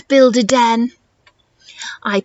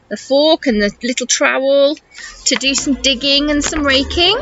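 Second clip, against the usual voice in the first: ordinary delivery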